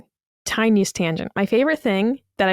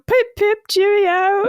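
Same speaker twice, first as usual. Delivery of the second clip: high, very slow hammed-up cheerio; mock posh British; 10 semitones higher, slower than usual. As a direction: stretch out a silly posh English farewell. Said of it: "but what do they say?".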